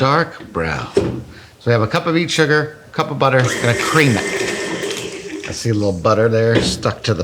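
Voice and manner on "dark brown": "Dark brown" drawn out slowly; odd emphasis. Real, not acted. Cook repeating "Dark brown" after being questioned, oddly drawn out. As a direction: stretch out both words of "Dark brown". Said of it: "Why are you saying it like that".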